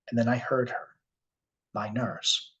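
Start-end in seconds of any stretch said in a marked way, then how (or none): none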